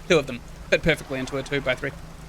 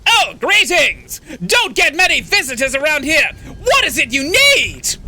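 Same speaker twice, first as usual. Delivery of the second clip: put-on loud, high old man voice; high-pitched, shouted old-timer greeting; old man character; 9 semitones higher, 6 dB louder than usual. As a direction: call out as an eccentric old hermit hailing visitors. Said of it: "An old man emerges from one of the nearby".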